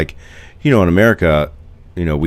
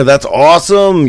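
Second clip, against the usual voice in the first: bright, high, loud "That's awesome"; thrilled "That's awesome" in a raised voice; excited; 11 semitones higher, 6 dB louder than usual. Real, not acted. Greets viewer from Argentina.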